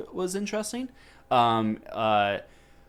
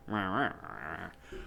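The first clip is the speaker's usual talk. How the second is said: cartoon Cheney grunting; 4 semitones lower, 10 dB quieter, slower than usual. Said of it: "Lil Bush, where it's just like".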